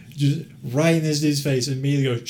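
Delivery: normal talking voice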